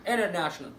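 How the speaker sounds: "international" as "innernational"; silent T. Shown as a demonstration. Teacher repeating the result, silent T. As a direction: drop the first T in "international". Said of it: "اطبق قاعده السايلنت تي عليها".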